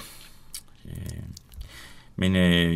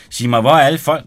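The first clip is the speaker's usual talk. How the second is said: higher, louder puzzled demand; loud disbelief; 5 semitones higher, 11 dB louder than usual. Acted. Frede at the empty party, loud surprise. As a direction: ask where everyone went in disbelief.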